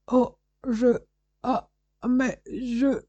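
high, slow, broken stammer. A narrator's reading. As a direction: struggle for words, dazed and shaken. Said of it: "balbutia Piedbald".

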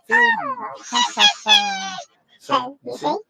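squeaky sped-up chipmunk laugh; as high as it goes. Shown as a demonstration. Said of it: "I can go really high".

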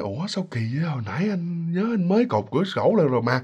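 soft, puzzled husband to himself; acted puzzlement. Husband finds the tied window wide open, acted quiet puzzlement. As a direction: drop the volume and wonder aloud.

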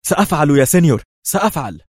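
high, loud, frightened promise; panicked cry. A narrator's reading. Terrified informant promising to obey, panicked and high. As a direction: blurt it out in alarm. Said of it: "هتف لوبيز مذعورا".

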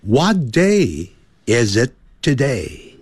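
slow, clear "What day is it today?" with linked "is it"; slow model reading. Shown as a demonstration. Teacher leading students through the sentence again, slow and clear. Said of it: "再来一遍".

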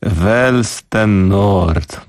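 Welsten Nord drawn out very slowly; struggling pronunciation. Real, not acted. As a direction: sound out Welsten Nord like a baffling foreign name. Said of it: "to państwo sobie chyba drwią ze mnie".

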